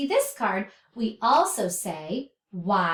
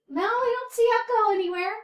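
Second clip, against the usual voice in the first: usual speaking voice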